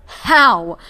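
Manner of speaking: a sharp, loud, high "How?"; loud demand. Acted. At the raven, a sharp loud demand. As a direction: bark the question.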